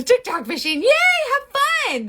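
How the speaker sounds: loud, high cheer. Pretend. Cheering in mock celebration.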